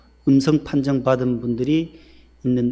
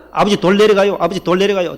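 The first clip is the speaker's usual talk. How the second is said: the warning called out louder and higher, in practice; louder faster drill; 6 semitones higher, 6 dB louder than usual. Retold or imitated. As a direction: bark the warning out quickly like a drill. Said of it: "빨리빨리 해봐라". (아들이 병원에서 연습하며 크고 빠르게 외침)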